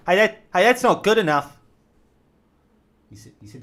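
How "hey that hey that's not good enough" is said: loud, high shouted call; yelling up. Retold or imitated. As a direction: shout it up to him. Yelled up to the guy.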